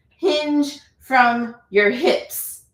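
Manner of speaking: "Hinge" drawn out very slowly, rising; slow emphatic cue. Shown as a demonstration. Teacher repeating the cue to students, very slow. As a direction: stretch every word for the class.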